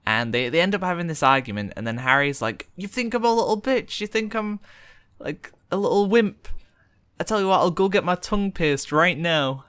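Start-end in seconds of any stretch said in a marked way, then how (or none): 2.8-9.6 mildly indignant, higher-pitched retort; mock a friend's wounded protest. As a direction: act out a touchy boast. Retelling friend's indignant drunken argument.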